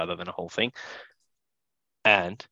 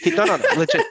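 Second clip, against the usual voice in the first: laughing protest; 8 dB louder, faster than usual. Real, not acted.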